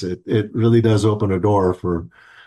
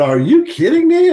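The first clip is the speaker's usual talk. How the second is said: much higher and louder, astonished; stunned disbelief; 5 dB louder than usual. Retold or imitated. Meeting the health chief's daughter abroad, astonished high cry. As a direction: shoot up in disbelief. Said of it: "I said".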